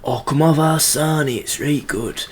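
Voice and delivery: high mock Yorkshire offer; cheerful Sheffield local pushing a sarnie; Sheffield accent. Mocking Sheffield locals selling a sarnie, high Yorkshire voice. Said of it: "people in Sheffield being like".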